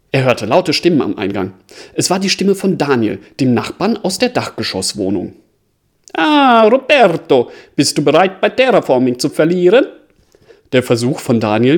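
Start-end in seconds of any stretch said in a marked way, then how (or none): 6.1-9.8 put-on playful Italian accent, loud and high. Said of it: "mit italienischem Akzent zu sprechen".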